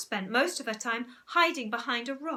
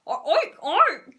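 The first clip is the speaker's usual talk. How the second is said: much louder, confident pig oinks; louder oinking; 7 semitones higher, 11 dB louder than usual. Acted. Zoo owner oinks louder as urged. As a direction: really belt out the oinks. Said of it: "getting rather the hang of it".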